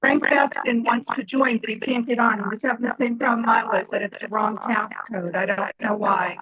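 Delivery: usual speaking voice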